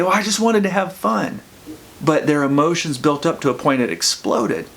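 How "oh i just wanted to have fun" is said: baffled innocence. Retold or imitated. Confused friend's inner thought.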